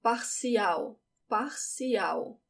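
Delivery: Parcial ending in w, parsiau; Parcial, L like the W in now; final L as W. Shown as a demonstration. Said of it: "Look at that L again".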